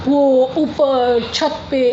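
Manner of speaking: put-on slow, drawn-out childlike words; imitating the toddler. Mother imitates her toddler naming the ceiling, slow and childlike. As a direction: copy her little girl's careful baby talk. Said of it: "तो फिर वो बोलती है".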